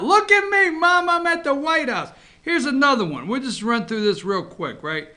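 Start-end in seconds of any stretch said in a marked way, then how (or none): 0.0-2.1 high-pitched, gleeful boast to Mom; proud dog voice. Acted. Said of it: "Check this guy out".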